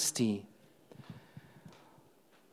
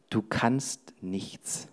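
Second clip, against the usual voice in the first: slow, much softer, lower murmur; whispering thought; 8 semitones lower, 11 dB quieter, much slower than usual. Acted. The preacher voices the thought that you can do nothing, low and soft. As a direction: let each word sink in quietly. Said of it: "der flüstert mir zu".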